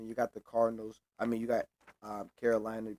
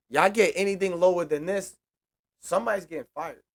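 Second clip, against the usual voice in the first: loud, raised-pitch fan rant; emphatic warning; 5 semitones higher, 7 dB louder than usual. Real, not acted. Fan warning somebody will be fired, heated and emphatic. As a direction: lay down a loud ultimatum. Warns of firings over poor record.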